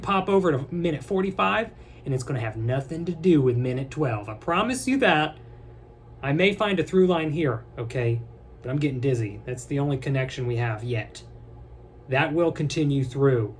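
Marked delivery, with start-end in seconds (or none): none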